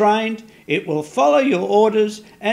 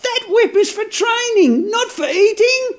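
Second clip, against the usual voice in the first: frantic shouting; 9 semitones higher, 3 dB louder than usual. Acted. Elch yells as the goat eats the whip.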